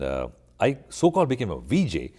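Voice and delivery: normal talking voice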